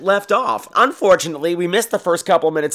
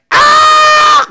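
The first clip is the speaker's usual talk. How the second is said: mock shriek; 12 dB louder than usual. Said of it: "She's like".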